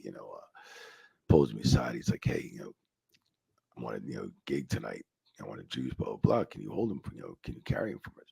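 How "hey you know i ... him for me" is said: low, hushed asking a favor; hushed request. Retold or imitated. Batista pulling him aside, hushed ask. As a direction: keep it down, asking a quiet favor.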